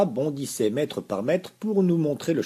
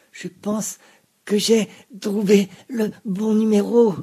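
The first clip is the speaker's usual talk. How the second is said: high, loud rasping man's voice; hoarse voice; 9 semitones higher, 10 dB louder than usual. A narrator's reading. Vénou winks at the narrator, rasping voice. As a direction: rasp it out, pleased with himself. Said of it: "dit d'une voix rauque".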